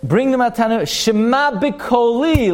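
mother's urging. Retold or imitated. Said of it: "she says".

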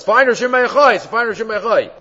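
high, loud mock scream of a possessed girl. The possessed princess screams for Shimon Yochai, high loud mock scream. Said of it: "all she was screaming was".

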